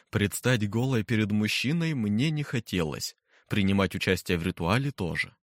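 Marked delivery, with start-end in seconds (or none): none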